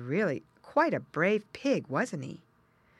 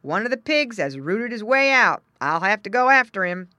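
loud, raised exclamation; exclaiming farmer; 5 semitones higher, 10 dB louder than usual. A narrator's reading. Farmer finds a pig gone, exclaiming. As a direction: announce the discovery loudly. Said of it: "exclaimed the farmer".

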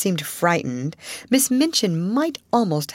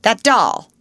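louder, higher outburst; cried out; 5 semitones higher, 7 dB louder than usual. Acted. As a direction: fling out the words in scorn. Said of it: "cried Miss Minchin".

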